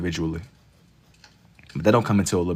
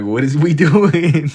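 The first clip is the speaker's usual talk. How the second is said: laughing slowly, louder; 7 semitones higher, 10 dB louder, slower than usual. Real, not acted. Streamer laughing at a chat message, slowed. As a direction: crack up mid-question.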